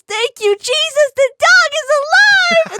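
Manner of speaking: very high, loud, stretched shout of relief; hammed-up jubilation. Imitating a friend when the dog reappears, hammed-up jubilation. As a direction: shriek praise, dragging out the last word. Acting friend's joy at dog's survival.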